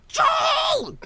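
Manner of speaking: high-pitched mock yell of a mother; imitating an angry mother's shout. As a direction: call out sharply like a pissed-off mom. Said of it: "my mother is so pissed at me".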